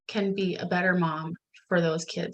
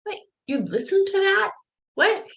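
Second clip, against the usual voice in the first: surprised disbelief; 13 semitones higher than usual. Retold or imitated. Mom amazed son repeated her.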